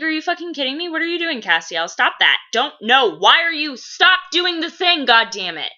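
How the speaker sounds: frantic telling-off of a wayward brother; Balthazar scolding. Acted. Balthazar fuming at Castiel's choices, exasperated scolding. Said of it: "that's a lot of Balthazar's, like, internal monologue".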